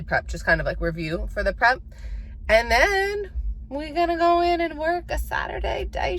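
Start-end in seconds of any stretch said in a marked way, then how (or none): 2.5-6.2 high, drawn-out hype shout; excited. Real, not acted. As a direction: sing it out like a party call. Hyping up her work shift.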